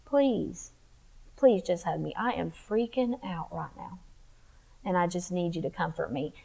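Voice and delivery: hushed plea to Jesus for comfort; whispered prayer. Retold or imitated. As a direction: whisper it like praying alone at night. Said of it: "I would just say".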